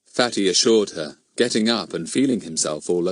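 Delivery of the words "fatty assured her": ordinary delivery, nothing marked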